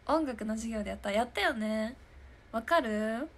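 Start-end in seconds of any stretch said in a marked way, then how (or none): none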